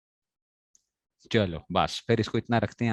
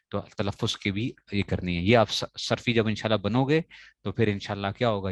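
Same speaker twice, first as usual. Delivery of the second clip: normal talking voice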